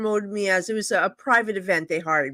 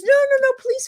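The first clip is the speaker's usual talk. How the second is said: pleading; 5 dB louder than usual. Retold or imitated. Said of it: "And she goes".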